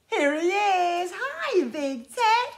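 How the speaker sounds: high, loud, delighted welcome; excited greeting. Pretend. Leader welcoming Big Ted, excited. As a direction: greet the teddy in a big lifted voice.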